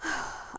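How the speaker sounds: quiet wistful sigh; soft sigh. A narrator's reading. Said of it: "Then she sighed".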